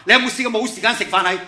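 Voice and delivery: emphatic fast repeat. Acted. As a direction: fire the question back quickly. Repeats question more forcefully.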